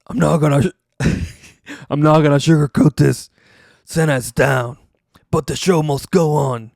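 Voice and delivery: a hammed-up grim backstage announcement; imitating Booker T. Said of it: "Booker T is just like".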